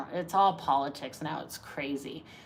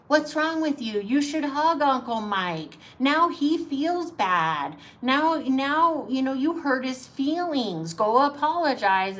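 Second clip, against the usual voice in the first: higher-pitched guilt-tripping relative; mock parental scolding; 6 semitones higher, slower than usual. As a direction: imitate a parent shaming a child. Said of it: "after you've been told ever since you're a kid".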